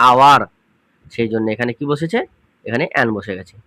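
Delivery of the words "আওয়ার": আওয়ার said louder, starting on a with no h; demo pronunciation. Shown as a demonstration. Teacher answering students' question, loudly modelling আওয়ার. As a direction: say আওয়ার out loud, dropping the h. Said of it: "ও এর উচ্চারণ শুরুতে হয়ে যাচ্ছে".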